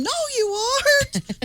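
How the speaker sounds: high, slow, half yelled through laughter; laughing mock yell. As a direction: fake a yell but crack up. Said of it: "I'm yelling at him".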